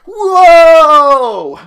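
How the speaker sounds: loud, high shout of amazement; mock amazed whoa. Character tumbling fifty feet back, shouting whoa. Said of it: "It's like".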